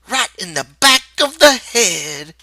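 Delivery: usual speaking voice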